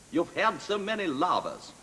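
an actor's laboured attempt at Russian; Russian accent. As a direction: drag it out in a mock Russian accent. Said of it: "he had to do a Russian accent".

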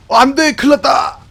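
high, loud panicked cry; panicked shout. Real, not acted. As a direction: shriek in sudden panic. Sudden danger in game.

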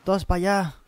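loud, high rallying cry; rally the troops, shouting; excited shouting. Real, not acted. Sending troops to attack, excited.

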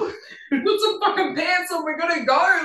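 laughing mock shout. Rushing bandmates out the door, laughing mock shout. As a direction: bark it while cracking up.

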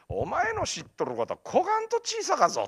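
high-pitched, full Kumamoto dialect mentor. Retold or imitated. Mentor to student on how little he knows, emphatic Kumamoto dialect. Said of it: "熊本弁が師匠のセリフみたいな".

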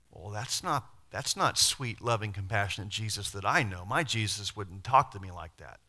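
normal talking voice

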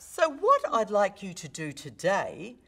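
high attack, then stepping down; starting up, coming down. Shown as a demonstration. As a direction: open high and loud on SO WHAT, descend toward TODAY. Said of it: "I've started up and coming down".

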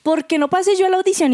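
high-pitched demanding question; rude-sounding challenge. Acted. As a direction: ask it as a bold, indignant challenge. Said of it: "suena muy grosero decir".

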